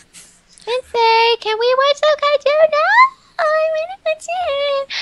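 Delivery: put-on high, squeaky, cutesy anime girl. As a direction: play an eager little anime girl. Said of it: "the little anime girl voice".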